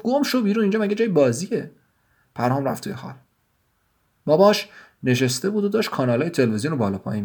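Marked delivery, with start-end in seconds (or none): 0.0-1.7 a read scream carried by high pitch more than volume; screaming sister. Acted. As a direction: shriek it out at her brother, pitched high. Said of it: "با جیغ گفت".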